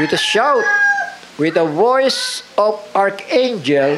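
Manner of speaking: slow, ringing proclamation of the shout; proclaimed scripture. Acted. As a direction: call it out like a herald, slowly. Preacher proclaims the archangel's shout. (mabagal at malakas na pagpapahayag)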